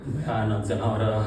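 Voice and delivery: low, soft, slow dread; deep, slow stage murmur; hushed horror. Acted. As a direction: draw it out quietly, full of dread. Hushed horror before outburst.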